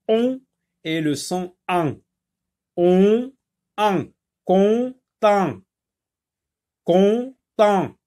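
"on" and "an" sounded apart, then "content" as con-tent; loud, high teacher demo of "content" in two beats; on versus an. Shown as a demonstration. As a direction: contrast the nasal vowels in "on", "an", "content". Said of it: "la différence qu'il faut faire entre le son".

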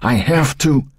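high, pleading insistence; insistent old woman. A narrator's reading. Old woman cut short while arguing with the fireman, insistent. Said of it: "the old woman insisted".

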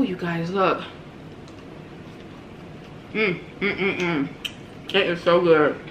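chewing while talking; eating with fingers; mouth full. Real, not acted. Seen 4: Picks flaky fish by hand, puts food in mouth, chews between words.

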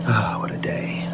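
tired whispered sigh to himself; worn-out reporter murmuring alone in his car; weary whisper. Acted. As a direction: let the long day drain out in a whisper. Exhausted detective alone in car.

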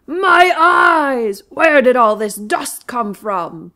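loud furious lion, slow on the question; a blinded, raging lion; shouting lion. Acted. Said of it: "shouted Lion".

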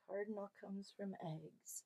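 soft confiding whisper. Acted. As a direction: whisper the answer like a secret. Answer told to children.

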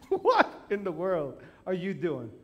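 laughing disbelief. Retold or imitated. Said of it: "I'm like".

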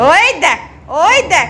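loud, bright call to look. Real, not acted. Grandmother calling family to look, loud call. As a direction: call out loudly to catch attention.

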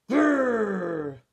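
loud angry growl; grumpy growling lion. A narrator's reading. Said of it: "Lion growled".

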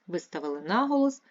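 usual speaking voice

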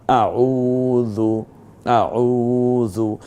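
আউযু recited very slowly, au drawn out; lengthened au. Shown as a demonstration. Teacher reciting, holding the আউ in আউযু. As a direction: stretch the আউ of আউযু. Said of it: "এই যে আউ পড়লাম কেন?".

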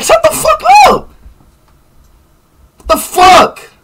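real enraged yell; furious shriek. Real, not acted. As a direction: lose it at the chat. Raging at chat again.

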